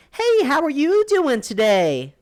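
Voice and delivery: high, slow, sing-song customer-service voice; mock cashier greeting. Mocking the gas station cashier's scripted greeting, high and drawn out. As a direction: ape the cashier's fake-cheery hello. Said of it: "Why are you talking like that?".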